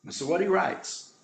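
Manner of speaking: usual speaking voice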